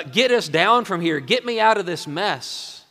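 loud, high-pitched insistent demand; forceful, self-serving appeal; demanding first criminal. Acted. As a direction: bark it out as an order to be rescued. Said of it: "the first guy, he's saying".

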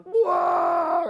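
loud, high mock scream of anger; frustrated roar. Father overcome by righteous anger, acted scream. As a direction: let out a drawn-out exasperated yell. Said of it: "I just wanna scream".

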